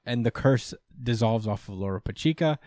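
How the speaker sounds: ordinary talk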